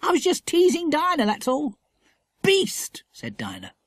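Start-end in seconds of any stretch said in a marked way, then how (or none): 0.0-1.7 high, playful schoolboy; light, pitched-up boyish excuse; teasing boy. A narrator's reading. Boy admits teasing his sister. 2.4-3.0 high, indignant single word; pitched-up childish crossness; cross girl. A narrator's reading. Girl cross at teasing brother.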